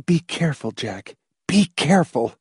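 urgent warning grated close in the ear. A narrator's reading. Wife grating a warning in her husband's ear, grated. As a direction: grate it out, stressing "be". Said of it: "grated in his ear".

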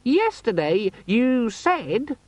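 high, slow, squeaky accusation; angry demon. A narrator's reading. Demon accusing its owner, angrily squeaking. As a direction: complain as an aggrieved little demon. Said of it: "gave him an angry look".

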